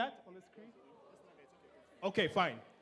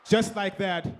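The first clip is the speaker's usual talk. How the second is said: ordinary talk, nothing marked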